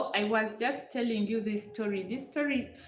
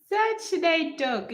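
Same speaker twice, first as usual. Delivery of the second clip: pitched up and louder in mock disbelief; exasperated question; 9 semitones higher, 6 dB louder than usual. Real, not acted. Answering a viewer who says don't talk English, exasperated. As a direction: toss the question back, half laughing, half protesting.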